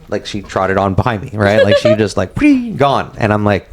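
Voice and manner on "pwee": high whooshing pwee; mock zoom-off noise; high zip noise. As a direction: make a quick zipping-away sound. Woman speeding off.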